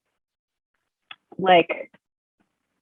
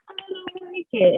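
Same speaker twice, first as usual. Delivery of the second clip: much higher, disapproving mom; 13 semitones higher than usual. Retold or imitated. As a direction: voice mom's flat rejection. Mom quoted disapproving tattoo.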